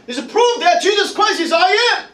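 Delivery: high, rising pulpit proclamation; fervent preaching. Real, not acted. Preacher proving the Bible points to Christ, fervent preaching. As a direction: drive the proof home to the congregation.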